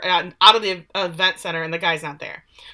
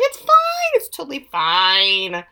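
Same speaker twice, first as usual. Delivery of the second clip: insistent reassurance; 4 dB louder, slower than usual. Retold or imitated. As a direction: brush off the worry with exaggerated insistence. Insisting to worried companion.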